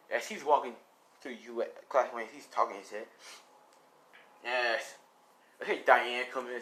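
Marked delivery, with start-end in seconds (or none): none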